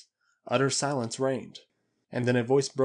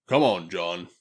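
low, firm and loud; lightly voiced gruff companion; gruff urging; 6 semitones lower, 5 dB louder, slower than usual. A narrator's reading. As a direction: urge a friend along in a low voice. Character gruffly urges companion along.

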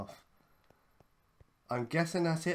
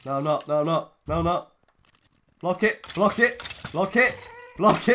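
louder, rising frantic cries; loud panic; 4 semitones higher, 11 dB louder than usual. Real, not acted. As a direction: panic loudly, higher each time. Panic, mobs attacking.

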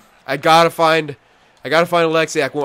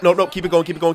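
ordinary talk, nothing marked

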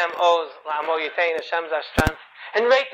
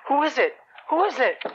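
higher-pitched, mildly fearful question; scared; 6 semitones higher than usual. Acted. Mother hearing a knock at the door, scared. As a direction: ask it anxiously, a touch hammed-up. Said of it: "she was scared".

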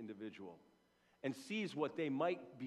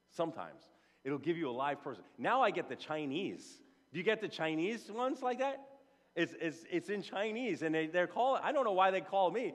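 ordinary delivery

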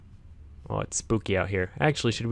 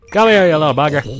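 louder, higher mock-aggressive call; playful loud taunt; 6 semitones higher, 11 dB louder than usual. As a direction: yell after a fleeing mob. Chasing a mob.

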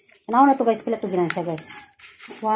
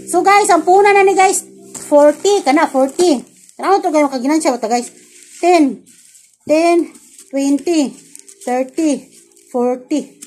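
excited loud chatter; 6 semitones higher, 11 dB louder, faster than usual. Real, not acted. Loud excited talk to viewers.

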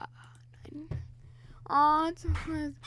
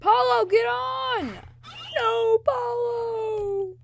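desperate shout; 9 semitones higher, 5 dB louder, much slower than usual. Real, not acted. Friend falls in game.

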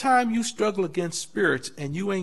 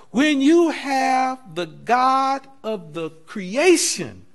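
loud, emphatic preaching; slow, loud pulpit cadence; preaching at full force; 7 dB louder than usual. Real, not acted. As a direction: proclaim it to the congregation.

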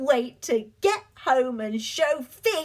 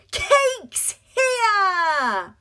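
loud high party cheer; 6 semitones higher, 5 dB louder than usual. Acted. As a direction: cheer as he arrives. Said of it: "they cheered".

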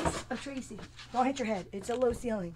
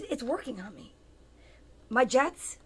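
usual speaking voice

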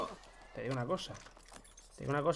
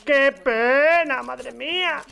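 mock lament; 7 semitones higher, 6 dB louder, much slower than usual. Mock lament over fallen enemy.

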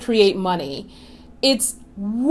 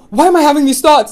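louder, higher, fast fretting; anxious; 4 semitones higher, 5 dB louder, faster than usual. Shown as a demonstration. The wrong way to react to a thought, fretful and fast. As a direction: fret at yourself in a rush. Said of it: "It's not".

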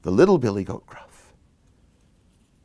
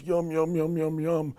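higher, soft yum-yum chant; relishing; 5 semitones higher, 6 dB quieter than usual. Acted. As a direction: savour imagined tasty food, softly. Relishing imagined delicious grass.

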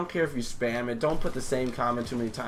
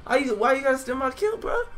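very high-pitched protest; high, whiny outrage; indignant high whine; 13 semitones higher, 3 dB louder than usual. Real, not acted. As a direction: complain in a squeal at a teammate. Protesting a stolen kill.

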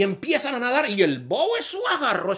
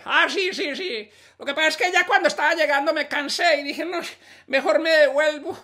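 dim-witted character voice; 11 semitones higher than usual. Acted. Bobo answers.

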